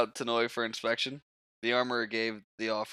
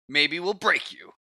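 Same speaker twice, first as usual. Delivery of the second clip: loud, high, drawn-out threat; menacing bully; 8 semitones higher, 7 dB louder than usual. Acted. One of the bullies threatens John, loud high menacing voice. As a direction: throw the threat out loud and slow. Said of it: "one of the rapers said".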